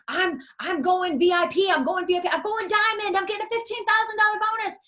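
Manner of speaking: high, loud, excited bragging; speeding up into a high giddy brag; giddy boasting. Retold or imitated. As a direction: imitate a self-convinced dreamer. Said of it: "You're convinced".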